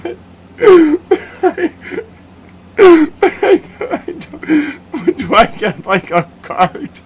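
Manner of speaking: very high, slow voice broken by laughter; laughing impression. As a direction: stammer like a lost staffer, laughing. Person asks for a cart.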